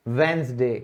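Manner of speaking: Wednesday said "वेंसडे", no d sound; "वेंसडे" with the d dropped; silent d. Shown as a demonstration. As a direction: say "वेंसडे" as wens-day. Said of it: "वेडनेसडे मत बोलना".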